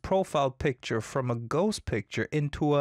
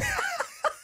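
high laugh; 3 dB quieter than usual. Real, not acted. Laughs at the comment.

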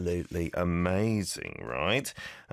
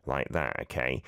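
ordinary talk

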